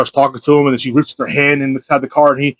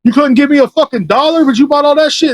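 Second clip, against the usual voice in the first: high, imitated angry accusation; aggressive; 13 semitones higher, 3 dB louder than usual. Imitating the man who was refused a dollar, aggressive. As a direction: act out an outraged confrontation, pitched high. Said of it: "came up to me all aggressive".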